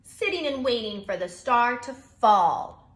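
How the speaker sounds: grumpy bear. Acted. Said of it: "replied Bear grumpily".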